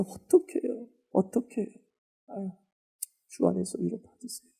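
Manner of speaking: soft, emotional sympathy; soft, tender consoling. Shown as a demonstration. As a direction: console the mourner gently. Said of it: "이래야지. 이렇게 감성이 섞여야지".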